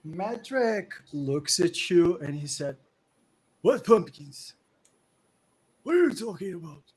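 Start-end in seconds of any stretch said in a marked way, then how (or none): 3.6-6.8 put-on higher, louder old man denying it; confused old man. Old man denying to the party any pumpkins. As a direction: brush them off like a forgetful old man.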